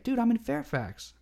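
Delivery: high-pitched retold amazement; amazed. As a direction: imitate a friend's astonished discovery, voice way up. Retold discovery that gamer lives nearby.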